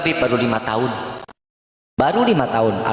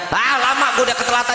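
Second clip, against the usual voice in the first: fast, hurried grumble about being late; impatient husband; 5 semitones higher, much faster than usual. Retold or imitated. Husband leaving for work, fast impatient complaint. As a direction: rush it out like a man late for work.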